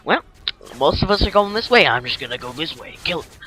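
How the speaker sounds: lightly voiced enemy explaining his stupid flank; dumb enemy voice. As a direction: play the enemies as clueless. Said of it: "they're like".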